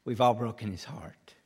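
normal talking voice

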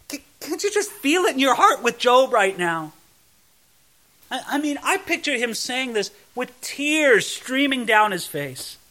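ordinary talk, nothing marked

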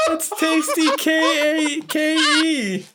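spelled out slowly and high through laughs; laughing. Real, not acted.

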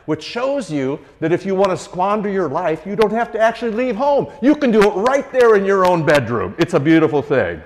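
high, loud fiery preaching; preaching at full force. Real, not acted. Preacher drives point home to congregation, loud and fervent. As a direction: lift the congregation with rising fervor.

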